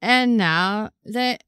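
slow, deliberate limerick; slow dramatic limerick; poem recital. Acted. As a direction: recite slowly, every word weighed. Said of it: "She goes".

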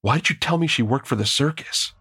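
high, sudden angry outburst; angry blurt. A narrator's reading. Narrator confronts Kurt about the circus, angrily blurting. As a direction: blurt it out, accusing. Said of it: "I angrily blurted".

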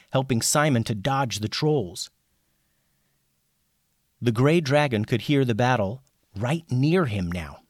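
ordinary delivery, nothing marked

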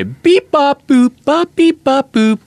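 high, slow beep bop noises; robot beeps. Acted. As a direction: sing the beeps high like a machine. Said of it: "bring you inside that Domino's pizza commercial".

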